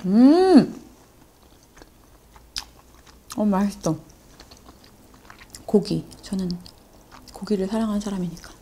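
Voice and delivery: speaking between bites; talking while chewing. Real, not acted. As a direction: mouth partly full of food. Seen 0: Eating from bowl throughout, jaw moving, mouth partly full while talking.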